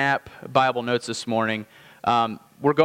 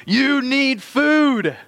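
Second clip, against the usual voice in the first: much higher, louder, very slow hungry cry; body screaming; 8 semitones higher, 4 dB louder, much slower than usual. Retold or imitated. Hungry body crying out for food, high, loud and slow. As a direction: let the starving body demand it. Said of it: "my body is screaming".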